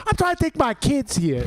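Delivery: high, exaggerated Boston accent; whiny Boston dad accent. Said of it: "It's the worst Boston accent possible".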